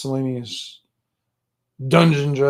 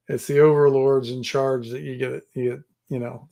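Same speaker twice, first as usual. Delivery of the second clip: ordinary talk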